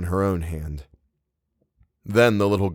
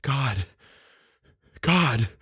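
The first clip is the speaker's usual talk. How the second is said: hushed, higher, then slow dread; shuddering horror; 7 semitones higher, slower than usual. A narrator's reading. Eleanor realizing she held an unknown hand, shuddering horror. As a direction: let the horror dawn slowly. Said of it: "stand shuddering in a corner".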